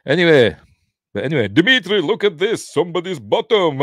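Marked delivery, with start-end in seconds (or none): 1.5-3.8 high, hammed-up comic call; silly put-on voice, pitched way up and louder; mock character voice. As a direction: call a pal over in mock glee. Replays son's call as film character.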